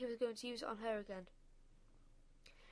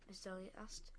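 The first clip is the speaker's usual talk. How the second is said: ordinary delivery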